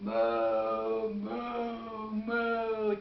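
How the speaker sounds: imitation of lowing cattle; cow mooing. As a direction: moo like a cow. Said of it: "Cows making cow sounds".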